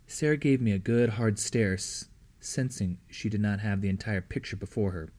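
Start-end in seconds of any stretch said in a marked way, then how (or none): none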